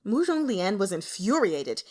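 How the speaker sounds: ordinary delivery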